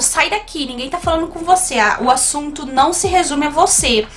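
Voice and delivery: ordinary delivery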